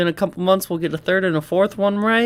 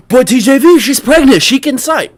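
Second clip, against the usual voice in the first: mocking critics; 10 semitones higher, 9 dB louder than usual. Mimicking critics' objection.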